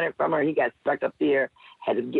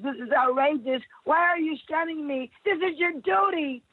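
high indignant protest; 12 semitones higher than usual. Acted. Accusing doctors in poem.

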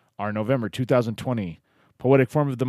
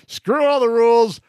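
loud, high, gleeful burst; excited outburst; 12 semitones higher, 8 dB louder than usual. Acted. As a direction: shout it like breaking free of rules.

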